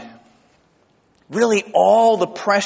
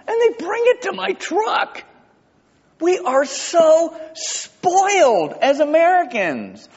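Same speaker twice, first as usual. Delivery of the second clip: much higher, almost shouting in mock amazement; excited exclamation; 13 semitones higher than usual. Real, not acted. Pastor marvelling at fast drive-thru service to congregation. Excited Chick-fil-A story.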